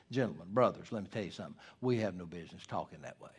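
soft and kindly, a pastor correcting fellow preachers; gracious rebuke. Retold or imitated. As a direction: gently set the brothers straight. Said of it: "he was gracious with that".

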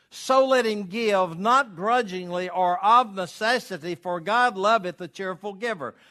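high, loud pulpit reading, slowing at the start; scripture read with force. Acted. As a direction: proclaim each clause to the congregation. Preacher reads verse aloud.

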